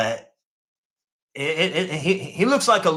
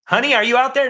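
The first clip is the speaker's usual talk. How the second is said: raised voice calling out for his wife; 9 semitones higher, 7 dB louder, faster than usual. Real, not acted. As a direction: call loud and high across the house. Calling out to someone off-room.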